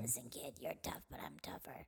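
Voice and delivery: raspy low whisper of a tough wise guy; smoker's voice. Pretend. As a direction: growl it out like an old smoker. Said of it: "our smoker's cough voices".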